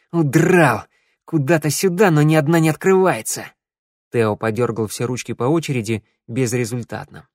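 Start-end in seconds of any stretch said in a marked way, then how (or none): 0.1-3.5 raised, exasperated voice; angry cursing. A narrator's reading. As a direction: vent frustration, higher and fairly loud. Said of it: "грязно ругалась". (раздражённо, с досадой)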